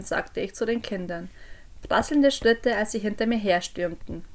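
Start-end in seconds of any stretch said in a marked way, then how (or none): none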